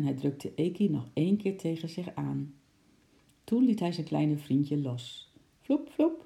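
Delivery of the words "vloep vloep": high, quick vloep sounds; whoosh of a leap. A narrator's reading. Narrator imitating the squirrel jumping, high sound effect. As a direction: imitate springy leaps, high-pitched.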